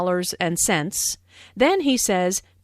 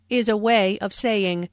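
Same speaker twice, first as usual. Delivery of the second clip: ordinary delivery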